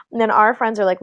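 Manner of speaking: ordinary talk, nothing marked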